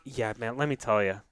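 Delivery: usual speaking voice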